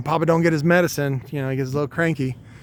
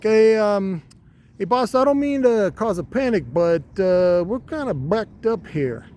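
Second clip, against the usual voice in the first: much higher squeaky voice, hesitant; nervously breaking bad news in a tiny high voice; talking testicle; 5 semitones higher than usual. A high-voiced testicle warning the boss they're backed up. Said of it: "the testicle's like".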